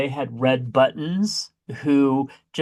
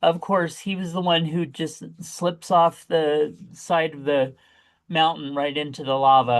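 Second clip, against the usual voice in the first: normal talking voice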